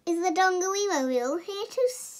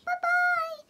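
high squeaky sing-song bye; puppet goodbye. Acted. Puppet sidekick waving goodbye to viewers in a high squeak. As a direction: chirp it as a tiny puppet.

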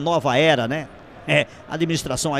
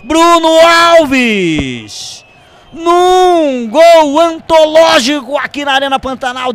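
roaring, drawn-out goal call, much louder and higher; explosive goal commentary; 9 semitones higher, 12 dB louder, slower than usual. Real, not acted. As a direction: shout the goal like a radio announcer at full force. Live radio goal call.

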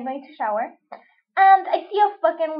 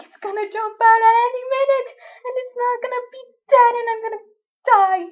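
high, hurried mock dread; half-joking panic, pitched up; scared; 8 semitones higher, faster than usual. Fearing the cricket in the bathroom trash, high hurried dread. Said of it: "I'm just scared".

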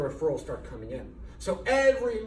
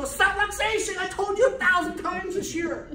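much higher, loud mock exasperation at Subluxation; exasperated correction; 6 dB louder than usual. Doctor correcting a forgetful patient, high and exasperated. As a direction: correct them with playful exasperation. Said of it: "You'll go".

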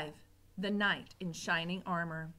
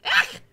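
high, loud cry of disgust; disgusted cook's squeal; 12 dB louder than usual. Acted. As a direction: recoil with a loud ugh. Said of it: "said Cook".

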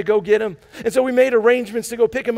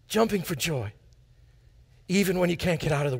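softer repeat; 5 semitones lower, 6 dB quieter than usual. Shown as a demonstration. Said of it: "repeated softer".

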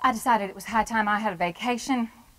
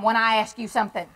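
ordinary talk, nothing marked

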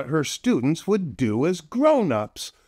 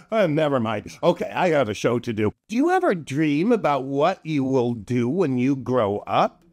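ordinary delivery, nothing marked

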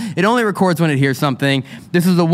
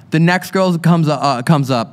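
ordinary talk, nothing marked

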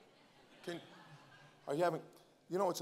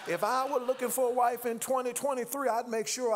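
rising preacher's shout, higher and louder; preaching shout; 6 semitones higher, 4 dB louder than usual. Acted. Preacher joking to the church about wives, shouting. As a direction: lift it into a sung shout over the organ.

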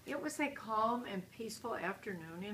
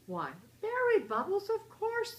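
eager; 11 semitones higher, 7 dB louder than usual. A narrator's reading. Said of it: "said Sister".

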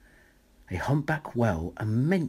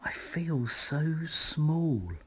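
slow, higher awed snail voice; a tiny snail, overwhelmed and slow; small snail; 6 semitones higher, 3 dB quieter, much slower than usual. A narrator's reading. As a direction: let the tininess sink in slowly. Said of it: "she said to the whale".

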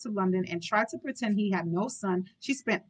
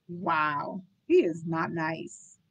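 usual speaking voice

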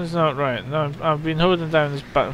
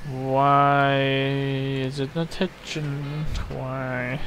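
slow puzzled drawl; 4 semitones lower, 4 dB quieter, much slower than usual. Real, not acted. Stuck, confused by the game.

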